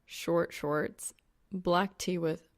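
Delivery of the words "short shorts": usual speaking voice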